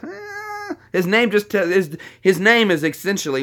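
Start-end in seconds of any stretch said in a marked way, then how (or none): 0.0-0.8 high, thin, soft "ah"; mock critic's groan. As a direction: imitate an unimpressed whine, high and drawn out. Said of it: "he's like".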